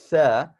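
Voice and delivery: loud tha with the tongue between the teeth. Shown as a demonstration. Teacher demonstrating tha with the tongue out. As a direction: bite the tongue lightly for tha. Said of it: "our tongue is coming between our teeth".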